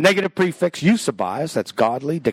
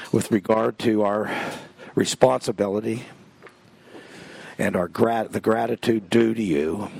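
spoken prayer; 5 semitones lower than usual. Real, not acted. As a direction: address God reverently, voice sinking toward the amen. Leads the closing prayer.

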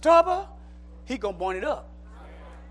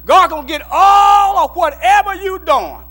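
loud rising preacher's cry; fiery preaching; 2 semitones higher, 10 dB louder than usual. Acted. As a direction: preach it at full force.

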